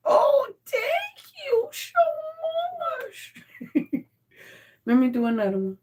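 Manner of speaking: very high-pitched squeal of thanks. Real, not acted. Owner thanking pet for another five, high gushing coo. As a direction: gush in a tiny high voice.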